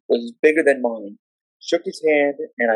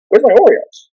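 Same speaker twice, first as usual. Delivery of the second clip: loud, high outburst; alarmed shout; 10 semitones higher, 8 dB louder than usual. Retold or imitated. Kid discovers his lunch treat missing, alarmed high shout. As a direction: yell it in high-pitched alarm.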